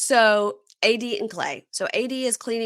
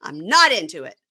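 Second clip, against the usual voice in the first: loud high ranting; shrill rant; 7 semitones higher, 8 dB louder than usual. Retold or imitated. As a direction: rant at him, voice rising. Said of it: "So she goes".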